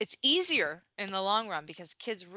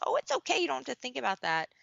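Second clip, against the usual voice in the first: much higher, sing-song parent brushing it off; mock soothing parent; 6 semitones higher, faster than usual. Parent hurrying a worried child past feelings, high mock coo. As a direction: coo it away like a hurried parent.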